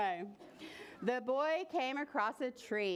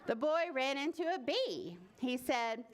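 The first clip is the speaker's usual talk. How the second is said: plain reading voice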